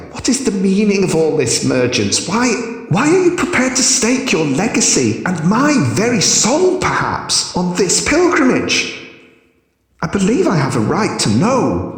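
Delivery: put-on high, insistent young priestess; high demanding voice. A narrator's reading. Omnia demands answers, high voice.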